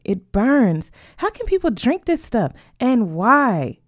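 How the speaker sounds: loud, high-pitched protest; burning-throat outcry. Acted. Sophia reacts to burning mezcal, appalled outcry. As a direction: cry out as the drink burns.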